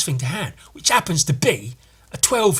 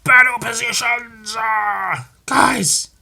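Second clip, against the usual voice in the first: 1 tiny alien soldiers; 9 semitones higher, 4 dB louder, much slower than usual. Acted.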